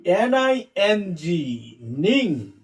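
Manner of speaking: teacher blending "এন আই এন জি" into the syllable "নিং"; broken-down pronunciation. Shown as a demonstration. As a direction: stretch the letters, then sound out "নিং" alone. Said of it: "উচ্চারণটা ভাঙ্গে পড়ি".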